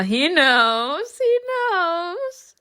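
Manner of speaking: drawn-out, climbing high; stretched, soaring realization; knowing squeal. Real, not acted. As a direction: let "knows" slide way up. Teasing reaction to the character's silence.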